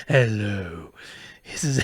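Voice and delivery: loud drawn-out grand hello; Andrew Lloyd Webber impression. Teller imitating Andrew Lloyd Webber's hello. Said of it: "he sounds ridi".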